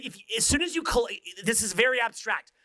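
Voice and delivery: ordinary delivery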